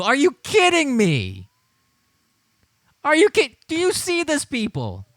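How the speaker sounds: high, loud disbelief; can't believe what you're seeing; incredulous. Real, not acted. Streamer asking his viewers in disbelief, incredulous.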